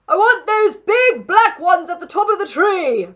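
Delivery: high, full-voiced demanding shout; man shouting. A narrator's reading. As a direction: shout orders up to someone in a tree. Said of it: "the man was shouting".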